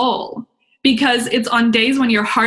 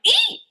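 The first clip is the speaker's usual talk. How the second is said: very high-pitched "eee" squeal; scared squeal. Acted. Speaker voicing her scared heart to listeners, squealing. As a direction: squeal like a frightened little heart. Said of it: "My heart was like".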